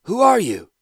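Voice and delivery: sharp, high, loud demand; high, loud suspicious demand; elder's challenge. A narrator's reading. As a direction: challenge the armed stranger.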